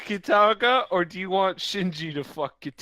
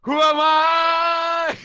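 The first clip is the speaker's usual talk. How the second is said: slow, drawn-out shout, much higher; dramatic shout; 9 semitones higher, 5 dB louder, much slower than usual. Pretend. Mimicking Jackie Chan's dramatic cry, slow shout. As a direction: bellow it like a movie hero. Quoting a dramatic movie line.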